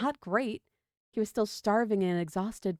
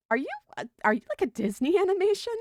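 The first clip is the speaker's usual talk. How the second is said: pitched way up in disbelief, quick and laughing; baffled laugh at a talking snake; high, fast, half-laughing disbelief; incredulous; 9 semitones higher, 3 dB louder, faster than usual. A narrator's reading.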